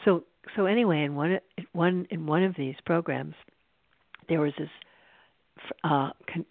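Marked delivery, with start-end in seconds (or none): none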